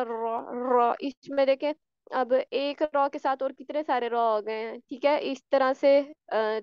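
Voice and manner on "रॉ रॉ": रॉ rolled with extra taps, the wrong way; over-roll the r of रॉ; rolled raa demo; trilled raa. Shown as a demonstration. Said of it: "जब हम अर्र करेंगे ना".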